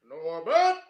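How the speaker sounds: a hint of a booming friend calling; lightly voiced John Lithgow. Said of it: "I'll do his voice".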